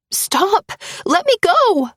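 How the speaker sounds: high, loud panicked yell. A narrator's reading. Woman grabbed by her ex, high loud panicked yell. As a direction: scream to be let go. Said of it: "I yell".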